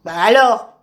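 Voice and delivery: furious tortoise; very angry. Acted. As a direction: demand it crossly. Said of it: "très en colère".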